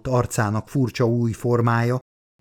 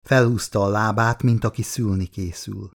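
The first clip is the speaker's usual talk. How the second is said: plain reading voice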